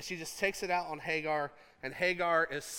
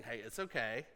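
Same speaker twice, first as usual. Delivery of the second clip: softer, lower, gentle reassurance; soft soothing comfort; 7 semitones lower, 8 dB quieter than usual. Pretend. Husband calming his upset wife, softly soothing. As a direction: hush her like a tender husband. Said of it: "calms her down with loving words".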